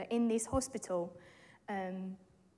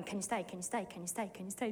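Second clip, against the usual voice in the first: soft, quick repeated plea from a frightened daughter; pleading softly; 4 semitones lower, 9 dB quieter, faster than usual. Retold or imitated. Daughter softly begging her mum to stay, quiet pleading. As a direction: beg softly over and over. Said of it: "Chloe's freaking out, going".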